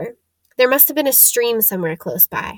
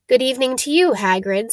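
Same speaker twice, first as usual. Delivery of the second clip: ordinary delivery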